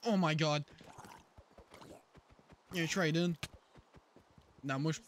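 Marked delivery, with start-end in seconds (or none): 0.1-3.3 loud, high panicked cry; panicking gamer. Real, not acted. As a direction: freak out at the enemy. Panicking in a game fight.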